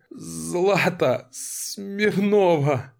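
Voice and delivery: slow, high, broken cry; terrified cry. A narrator's reading. Captive gives her name to Lera, slow high terrified cry. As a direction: drag her name out in terror, pitched high.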